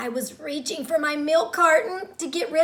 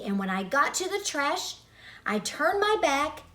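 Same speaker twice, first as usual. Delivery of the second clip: usual speaking voice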